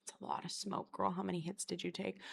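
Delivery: quiet, near-voiceless quoted manager; manager's whisper. Retold or imitated. Manager quietly teasing her about the vape smoke. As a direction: breathe it out like a knowing boss. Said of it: "She was just like".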